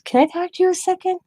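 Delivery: high, quick polite request; lightly put-on high voice; imitated stranger. Voicing the lady approaching her.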